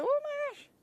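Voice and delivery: high, thrilled exclamation; excited. Retold or imitated. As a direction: replay the thrill. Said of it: "I was like".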